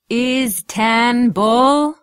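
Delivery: drawn-out loud Istanbul with a rise; loud slow Istanbul. A narrator's reading. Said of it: "spoke slowly and loudly".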